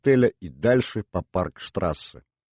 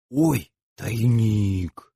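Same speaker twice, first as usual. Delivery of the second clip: very slow, drawn-out wonder; lingering gasp of surprise in words; awed discovery; 2 semitones higher, much slower than usual. Acted. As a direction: stretch out the amazed find. Girl discovers a hiding place.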